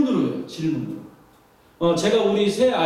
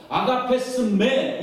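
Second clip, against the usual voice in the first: drawn-out question; much slower than usual. Acted. Jesus's question in Greek, drawn out.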